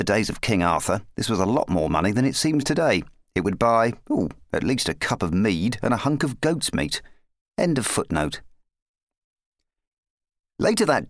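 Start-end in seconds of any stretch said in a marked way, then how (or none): none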